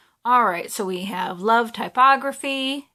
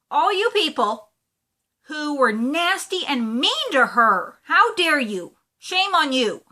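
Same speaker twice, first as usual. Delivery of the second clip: louder, heated telling-off of the mean people; scolding; 5 semitones higher, 4 dB louder than usual. Real, not acted. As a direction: scold them like a protective friend. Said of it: "that really made me mad".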